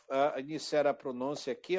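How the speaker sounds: ordinary talk, nothing marked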